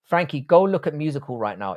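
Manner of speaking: ordinary delivery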